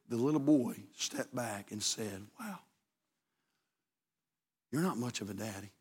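very soft, low, slowed telling of the ending; hushed storytelling. Acted. Pastor telling the father's turnaround, hushed and low. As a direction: draw the congregation in with a near-hush.

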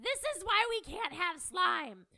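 furious parent yelling about slime; retold scream. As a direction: reenact the scream at your daughter. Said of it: "And I screamed".

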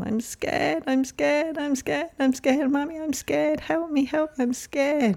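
very high, trembling repeats of scared; a terrified baby begging for mummy, pitched far up; scared child. Retold or imitated. Therapist reliving incubator fear, a high frightened plea. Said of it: "then the words came".

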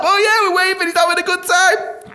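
high, cheery mock call; oblivious guards. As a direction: call back merrily, missing the danger. Oblivious guards answering drowning man.

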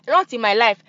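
usual speaking voice

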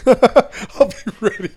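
lachend, traag nagedaan; laughing imitation. Friend repeats line laughing.

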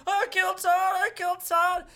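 high, loud mock-hysterical wail; put-on hysterical screaming. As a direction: cry it out as if hysterical, overacting.

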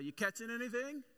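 high, loud call across the water; Jesus calling to the fishermen from the shore, high and loud. Retold or imitated. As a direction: shout out to the boat from the shore. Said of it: "Jesus yells out".

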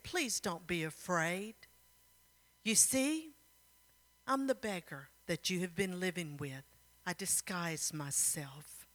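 hushed, soothing acted voice; gentle reassurance. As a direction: reveal it tenderly and quietly. King gently reveals himself, reassuring.

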